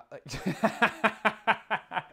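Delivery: loud, high burst of laughter; helpless rapid-fire laugh. Real, not acted. Laughs at a chat comment.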